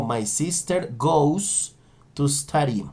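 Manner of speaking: slow, careful English sentence for students; slow model sentence. Shown as a demonstration. Teacher slowly models the English sentence, careful pace. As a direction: spell out each word slowly. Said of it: "Entonces yo digo".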